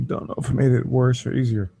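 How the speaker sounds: ordinary talk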